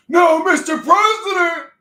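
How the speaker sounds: high, loud, slow wailing plea; pleading scream. Pretend. Streamer voicing a crowd begging the president, high slow scream. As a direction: wail it like a desperate supporter.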